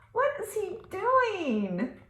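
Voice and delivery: ordinary delivery, nothing marked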